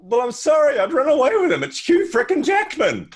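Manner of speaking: mock swooning wife voice; starstruck wife. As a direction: gush helplessly over him. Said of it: "She says".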